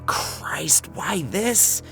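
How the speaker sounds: plain reading voice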